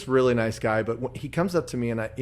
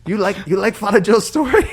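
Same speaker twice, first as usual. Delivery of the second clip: put-on Filipino priest accent, higher and louder; a beaming Filipino priest asking; 8 semitones higher, 10 dB louder than usual. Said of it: "Dude, your accents".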